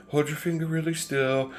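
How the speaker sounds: soft, high, careful instruction; gentle sing-song nurse voice; pretty nurse. Retold or imitated. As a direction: imitate a nurse coaxing a toddler. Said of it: "And she's like".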